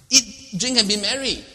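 loud, high party slogan; mock rallying cry. Preacher hamming up the catch cry, loud. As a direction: shout it like a toast.

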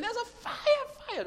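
alarm shout. Shown as a demonstration. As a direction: raise the alarm at the top of your voice. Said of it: "attract attention".

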